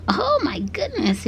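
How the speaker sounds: plain reading voice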